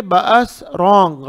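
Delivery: ordinary talk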